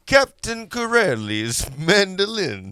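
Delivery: half-hearted Italian accent, slowed down; a shy mock Italian accent on the movie title. Shown as a demonstration. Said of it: "I wanted you to say that in an Italian accent".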